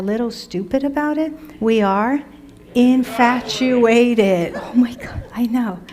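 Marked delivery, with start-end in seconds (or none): 2.7-4.5 "infatuated" stretched very slowly; drawn out. Shown as a demonstration. Teacher drags out "infatuated" to tease the students. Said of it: "teacher drawing out vocabulary word". 4.6-5.8 playful conspiratorial whisper. Acted. Teacher whispers a mock-scandalised aside to her students.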